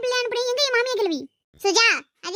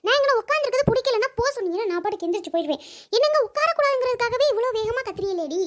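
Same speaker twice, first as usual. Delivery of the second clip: grumpy grandmother. Acted. Grandma scolding noisy kids playing near her, cranky. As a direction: scold like an irritated old grandma.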